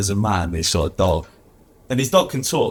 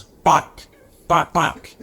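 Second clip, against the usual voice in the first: word yapped as a raised dog bark; 7 semitones higher, much slower than usual. Acted. Talking dog answering the tree question, barking. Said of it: "Your dog is barking".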